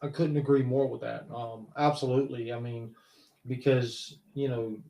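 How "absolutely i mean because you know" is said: ordinary talk, nothing marked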